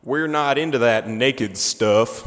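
imitating Georgian carnival workers' slow speech; terrible Georgian accent. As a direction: ham up a bad Georgian accent slowly. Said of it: "terrible Georgian accents".

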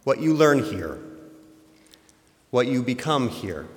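ordinary talk, nothing marked

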